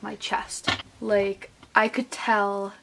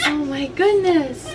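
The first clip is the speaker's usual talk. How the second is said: drawn-out sing-song to a fussing infant; 6 semitones higher, 4 dB louder, slower than usual. Real, not acted. Cooing to her baby.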